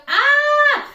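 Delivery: high loud mock scream of pain; a replayed dentist-chair scream; retold scream. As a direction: yelp out like the needle just went in. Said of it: "I was literally screaming".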